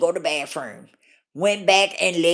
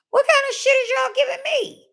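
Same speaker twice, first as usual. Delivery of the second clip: exasperated retold outburst. Repeating her demand to the counselor, pitched high in outrage. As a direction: pitch jumps way up in outraged disbelief. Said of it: "I said".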